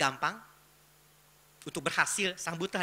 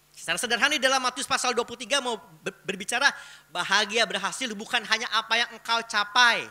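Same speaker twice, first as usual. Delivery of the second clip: loud, raised preaching voice; emphatic preaching; 7 semitones higher, 10 dB louder than usual. Real, not acted. Preacher citing Matius to the congregation, emphatic preaching. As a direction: drive the scripture point home, high and loud.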